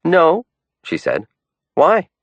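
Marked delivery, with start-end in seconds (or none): 0.0-0.5 higher, louder female voice, quick no; a startled girlfriend answering; higher surprised no; Sarah's voice, surprised. A narrator's reading. 1.8-2.1 higher, louder quick why; a girlfriend's startled why; Sarah's voice, curious. A narrator's reading.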